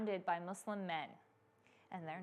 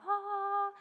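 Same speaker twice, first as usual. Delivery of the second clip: high, sustained angelic note; heavenly "ahh"; 10 semitones higher than usual. Retold or imitated. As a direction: imitate heavens opening with one held tone. Imitates heavenly light shining down.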